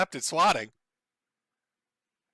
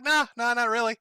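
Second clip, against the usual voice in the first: light, higher, offhand brush-off; mock casual denial; 6 semitones higher than usual. Streamer mocking a shrug at the police: high, airy denial. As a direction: toss it off like it's nothing. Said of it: "You're like".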